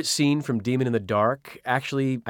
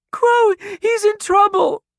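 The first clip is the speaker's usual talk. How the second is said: put-on high, pleading little girl; tearful child; 6 dB louder than usual. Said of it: "Tears streamed down Ruby's face".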